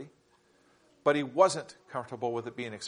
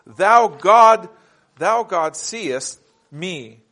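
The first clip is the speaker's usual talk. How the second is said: slow, loud "Thou God" held; solemn scripture emphasis; 2 semitones higher, 10 dB louder, much slower than usual. Acted. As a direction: intone the verse slowly. Quoting the text with emphasis.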